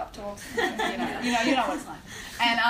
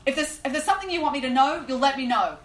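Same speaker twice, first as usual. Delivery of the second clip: raised, urgent plea to God; pleading aloud; 4 semitones higher, 6 dB louder than usual. Retold or imitated. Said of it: "I said that aloud".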